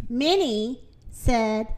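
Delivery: usual speaking voice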